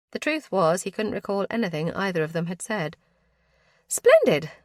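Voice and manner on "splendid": higher and louder, cheerful; pleased cousin. A narrator's reading. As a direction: chirp it with delight.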